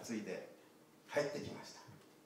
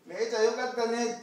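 high, louder friendly welcome; smiling welcome; 8 semitones higher, 7 dB louder than usual. Acted. Friend lamb welcoming her back with a smile. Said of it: "ニコニコ顔で迎えました".

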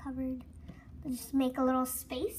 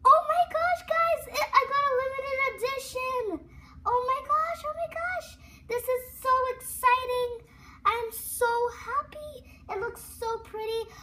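much higher, loud thrilled shrieks; screaming with excitement; 9 semitones higher, 5 dB louder than usual. Real, not acted. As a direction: shriek with joy over the prize. Limited edition reveal.

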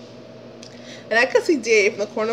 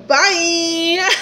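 higher, sing-song sarcastic bye; mock-cheery brush-off; 7 semitones higher, 4 dB louder than usual. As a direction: wave him off with a fake cheerful bye. Abruptly dismissing the jealous guy.

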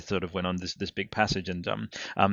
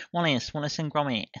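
higher, put-on Wallace-style Northern English accent; playful Northern English Wallace accent; 6 semitones higher than usual. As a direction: do a quick Wallace and Gromit accent impression. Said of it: "really shit at a certain accent".

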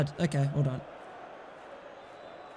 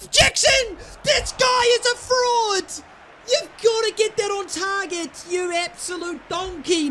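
frustrated yell; 6 semitones higher than usual. Real, not acted. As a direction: blast the striker for missing. Striker misses, furious.

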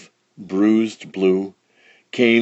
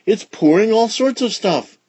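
excited exclamation; 12 semitones higher than usual. A narrator's reading. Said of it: "he exclaimed".

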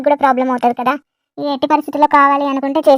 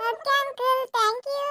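put-on higher, softer little kid's voice; young child; 7 semitones higher, 7 dB quieter, slower than usual. Little child thanking the uncle who promised sweets, high and soft. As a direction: thank him like a small child.

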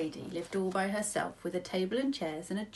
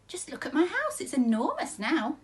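put-on 1 delighted old lady; 7 semitones higher, 3 dB louder than usual. A narrator's reading.

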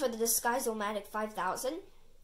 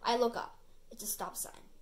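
ordinary delivery

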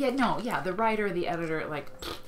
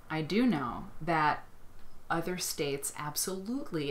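ordinary talk, nothing marked

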